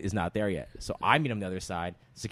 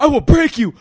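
I will break you screamed high and loud; full-force yell; screaming threat; 15 dB louder than usual. Retold or imitated. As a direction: roar the word break. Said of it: "So I'm like".

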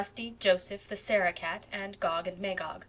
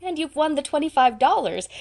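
louder, higher jubilant announcement; 7 semitones higher, 8 dB louder than usual. A narrator's reading. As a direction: burst out with the good news. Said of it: "continued Diana jubilantly".

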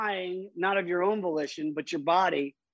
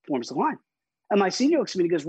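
ordinary delivery

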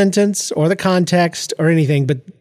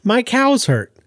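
"My cows hurt" tossed off lightly and higher; casual; 7 semitones higher, slower than usual. Retold or imitated. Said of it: "And she just casually says".

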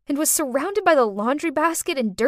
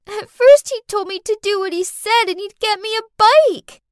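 put-on high, louder child voice; little girl confessing; 10 semitones higher, 8 dB louder than usual. A narrator's reading. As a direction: explain yourself as a young child. Child figure in the story.

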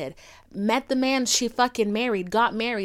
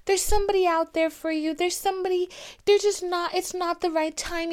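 mimicking friends in a raised, singsong pitch; empty platitudes; 9 semitones higher than usual. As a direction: recite hollow reassurance, pitched high. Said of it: "tell me empty platitudes".

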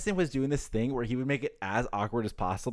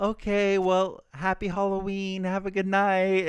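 higher, slow, politely strained goodbye; neighbor wrapping up; 6 semitones higher, slower than usual. Retold or imitated. Neighbor ending the chat with a lingering kid, slow and polite. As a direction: stretch it out, hinting he should leave. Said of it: "it's just like".

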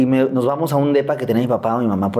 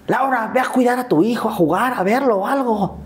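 much higher calling-out voice of a grandmother; grandma calling out; 10 semitones higher than usual. Retold or imitated. Grandma calling her daughter to mind the child: high call. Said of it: "le grita a Laura".

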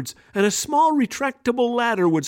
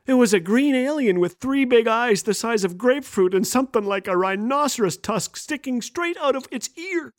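usual speaking voice

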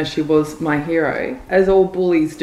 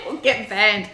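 laughing; 3 semitones higher than usual. Real, not acted. Echoing joke while laughing.

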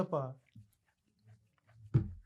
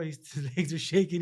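usual speaking voice

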